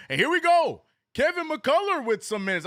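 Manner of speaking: excited call. Real, not acted. Streamer calls the Knicks game for viewers, high and hyped. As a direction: sell the moment like a live broadcaster.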